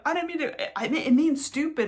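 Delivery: high-pitched imitated backpedalling, half laughing; mock flustered protest. Explaining what the substitute word meant, mock flustered. Acted back-and-forth about the word.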